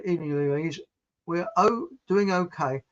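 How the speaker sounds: normal talking voice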